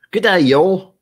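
loud, high Aussie greeting call; Australian accent. Acted. Streamer hailing an Australian viewer, in loud Aussie accent. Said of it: "slip into an Australian accent".

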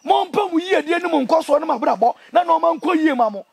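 normal talking voice

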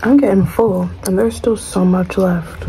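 words muffled by chewing; talking while chewing. Real, not acted. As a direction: mouth partly full of food. Seen 1: Fork to mouth just before; lips closed, working food while talking.

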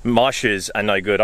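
usual speaking voice

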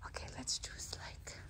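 soft whisper in the library. Real, not acted. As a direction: whisper so nobody is disturbed. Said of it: "Shh, library voice".

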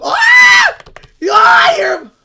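shrill high shriek of fright; panicked scream. Real, not acted. Gamer hearing a creeper close by, screaming.

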